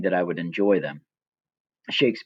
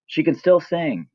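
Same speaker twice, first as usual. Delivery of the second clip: mock reporter; 7 semitones higher than usual. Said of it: "or it's".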